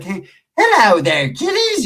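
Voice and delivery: high, slow cackly horror-host greeting; Crypt Keeper. Retold or imitated. Teasing a scraggly-haired homie, mock horror-host voice. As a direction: drag it out, then shoot way up high. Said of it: "You look like the fucking Crypt Keeper".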